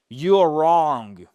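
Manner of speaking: loud, blunt and rude; blunt you're wrong. Shown as a demonstration. Teacher shows a rude reply instead of a polite one. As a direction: bark it bluntly. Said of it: "Au lieu de dire".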